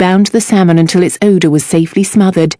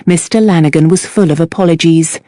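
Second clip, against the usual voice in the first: usual speaking voice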